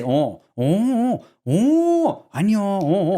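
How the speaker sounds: "oh" drawn out, each on its own tune, some swooping high; varied Korean "oh". Retold or imitated. Imitating her Korean phone call, "oh" in exaggerated changing tunes. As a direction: stretch each "oh" into a different meaning. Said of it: "depending how you say it".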